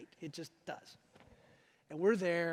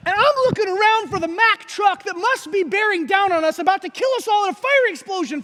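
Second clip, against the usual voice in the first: loud, high, alarmed retelling; mock panic; 11 semitones higher, 14 dB louder than usual. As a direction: exaggerate the panic at full volume. Mock panic retelling wife's gasp.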